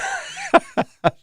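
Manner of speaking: squealing laugh; high laugh. Real, not acted. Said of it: "That was horrible".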